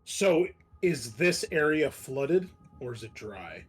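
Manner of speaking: ordinary talk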